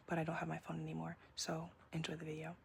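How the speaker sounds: unvoiced whisper; hushed sign-off to the editor; whispered aside. Real, not acted. Apologetic aside about bad audio.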